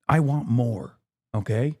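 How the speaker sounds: usual speaking voice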